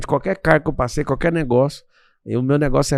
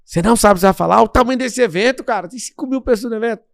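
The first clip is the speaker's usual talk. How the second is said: higher, louder, rushed disbelief; alarmed team; 9 semitones higher, 5 dB louder, faster than usual. Retold or imitated. His team confronting him before a huge event, alarmed and louder. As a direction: panic at the speaker going in unprepared. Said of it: "Fica todo o meu, meu time comigo ali".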